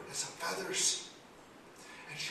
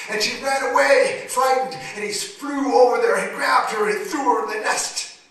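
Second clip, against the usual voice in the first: loud, high, urgent storytelling; storyteller at the chase, loud and high; dramatic narration; 9 semitones higher, 17 dB louder than usual. A narrator's reading. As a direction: drive the chase home.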